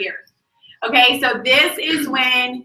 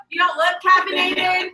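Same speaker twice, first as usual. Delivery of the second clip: ordinary delivery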